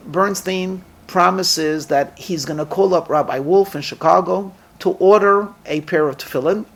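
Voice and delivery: usual speaking voice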